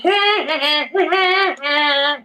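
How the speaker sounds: high laugh. Real, not acted.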